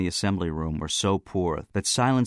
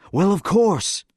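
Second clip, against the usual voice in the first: high, loud exasperation; exasperated; 13 semitones higher, 7 dB louder, slower than usual. A narrator's reading. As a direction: throw it out as obvious, exasperated. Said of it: "in an exasperated chuckle".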